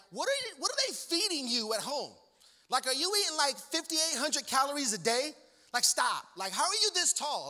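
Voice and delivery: high-pitched, disbelieving questioning; incredulous. Retold or imitated. Onlooker to very tall middle schoolers, incredulous. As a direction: question them in exaggerated disbelief.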